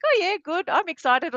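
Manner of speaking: mock "I'm excited" with no real excitement in it; unconvincing excitement. Husband claiming excitement to his wife, unconvincing. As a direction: say you're excited without meaning it. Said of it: "You don't sound very excited".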